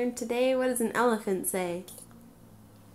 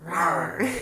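bear roar; 4 semitones lower than usual. Shown as a demonstration. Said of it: "What does a bear say?".

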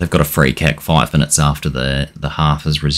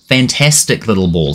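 normal talking voice

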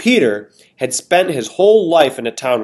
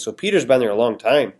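usual speaking voice

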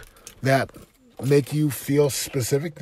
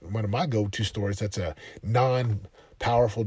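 ordinary delivery

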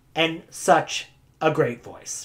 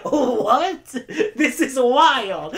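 laughing through it, slow at the end; laughing disbelief; 7 semitones higher, much slower than usual. Real, not acted. Telling about helium friend, laughing in disbelief. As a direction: giggle out your disbelief. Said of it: "And I was like".

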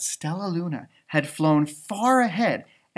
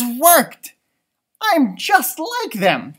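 excited thought; 7 semitones higher, 5 dB louder, slower than usual. Acted. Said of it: "thought Stellaluna".

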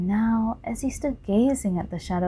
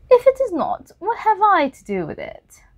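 retort; 13 semitones higher, 6 dB louder than usual. Acted. Lord Henry's retort.

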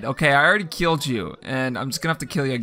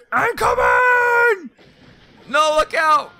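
much higher, slow stretched call; drawn-out shout; 4 dB louder, much slower than usual. Real, not acted. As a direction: holler across the lot. Calling out to sim.

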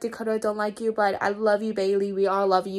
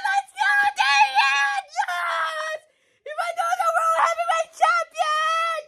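high excited screaming; screaming with joy; 13 semitones higher, slower than usual. Real, not acted. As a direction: shriek in joy for the winner. Cheering a title win.

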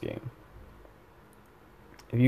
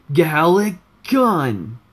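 full drawn-out attack yell, pitched up; [name]; 6 semitones higher, 5 dB louder, much slower than usual. Shown as a demonstration. Gamer retrying his character's attack, louder higher yell. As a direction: yell the move name slow and high. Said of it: "let me try it again".

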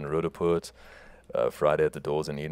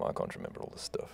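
whispered aside; 10 dB quieter, faster than usual. Real, not acted. Muttered aside about tour dates.

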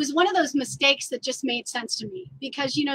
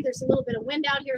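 ordinary talk

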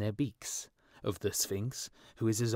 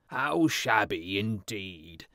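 louder, slow agreeing exclamation; councillors' cry; 5 dB louder, slower than usual. A narrator's reading. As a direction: echo the mayor loudly and slowly. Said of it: "cried the town councillors".

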